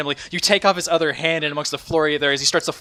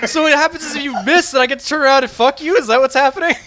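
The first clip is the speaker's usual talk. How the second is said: high, loud incredulous questioning; loud exasperated challenge to the player; incredulous rant; 13 semitones higher, 8 dB louder than usual. Real, not acted. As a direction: rant in raised, exasperated disbelief.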